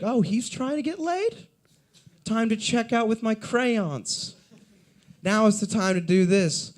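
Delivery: high, mocking voice of the whole crowd; mocking shoppers. Store customers jeer at a nervous guy, high mocking voice.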